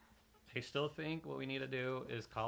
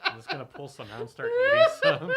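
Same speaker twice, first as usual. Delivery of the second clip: laughing hard; 15 dB louder than usual. Real, not acted. Table cracking up over candy corn.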